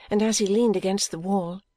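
ordinary delivery, nothing marked

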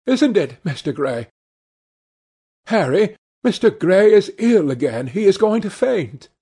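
high, urgent society lady; alarmed Duchess. Acted. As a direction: call out in rising concern for an ill guest.

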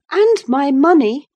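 high and loud, needling him; defiant young lady. A narrator's reading. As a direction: pile on the accusation, high-pitched. She continues her defiance.

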